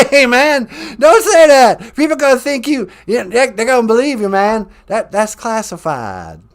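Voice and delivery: hammed-up Texan protest. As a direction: wave it off, it's classified. Bush denies involvement.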